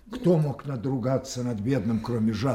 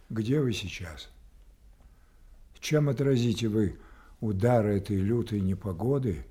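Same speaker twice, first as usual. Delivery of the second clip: usual speaking voice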